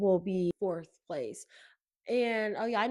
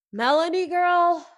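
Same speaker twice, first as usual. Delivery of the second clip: put-on raised, higher call of "girl"; sassy call across the room, louder; calling out to her; 6 semitones higher, 5 dB louder than usual. Calling out to Melanie on TV, raised and sassy.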